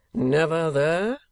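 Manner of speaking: slow, rising showman's question; a hint of theatrical surprise; the manager. A narrator's reading. Manager presses Nicholas about the town, mildly surprised.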